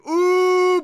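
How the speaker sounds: very high, loud "oooh!"; excited whoop. Real, not acted. Streamer seeing part five arrive, excited high whoop. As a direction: let out a big shrieking hoot.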